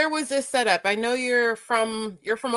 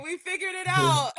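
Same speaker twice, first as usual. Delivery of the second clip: laughing; 8 semitones higher than usual. Real, not acted. Laughing at tech success.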